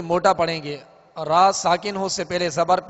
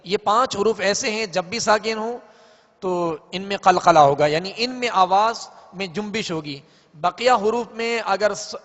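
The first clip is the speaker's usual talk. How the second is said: ordinary talk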